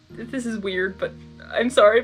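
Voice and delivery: plain reading voice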